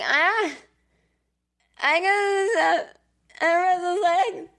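high, slow, whimpering complaint; whiny baby voice. Retold or imitated. Groggy patient whining to the nurse, high and slow. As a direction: drag it out like a miserable child. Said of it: "whining like a baby".

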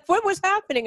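laughing. Retold or imitated. Husband's question, said while still laughing.

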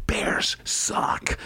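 emphatic stage whisper. Acted. Adviser sneaks a sports verdict to the mayor, whispering. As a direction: whisper it pointedly. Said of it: "whispers in the Lori Lightfoot's ear".

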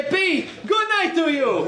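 put-on high, laughing Russian accent. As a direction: finish the joke in a thick Russian accent. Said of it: "doing a Russian accent".

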